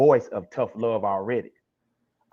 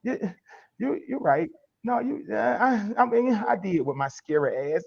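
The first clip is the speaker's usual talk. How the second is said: higher, halting concession; mock-meek, high-pitched giving in; sheepish backing down; 7 semitones higher, 5 dB quieter than usual. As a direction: stammer out a reluctant admission. Acts out listener backing down.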